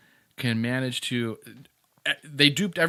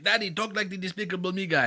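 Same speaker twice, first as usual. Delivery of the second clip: higher-pitched cartoon foreign accent; Despicable Me accent; 7 semitones higher than usual. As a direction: mimic the girls in a high, accented voice. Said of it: "I do an accent like that too".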